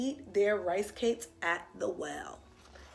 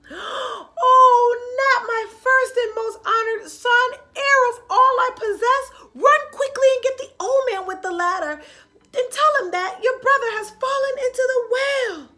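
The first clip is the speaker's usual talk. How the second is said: high, loud distressed wailing; alarmed cry; 13 semitones higher, 11 dB louder than usual. Acted. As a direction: cry out in panic for your son. Mother crying out in alarm.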